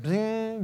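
high, soft, ringing "bing"; sudden ping noise. Shown as a demonstration. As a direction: imitate a little bell going off. Imitates sudden prompting of memory.

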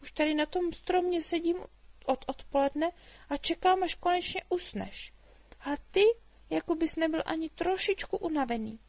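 put-on a chatty girl teasing the dragon; little girl Mája. A narrator's reading. Girl voiced in story. (vysoký živý dívčí hlas)

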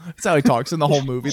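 usual speaking voice

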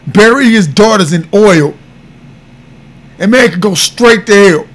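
loud, rising fury; shouting condemnation at a killer; angry. Real, not acted. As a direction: raw outrage building to a shout. Outrage over a man killing family.

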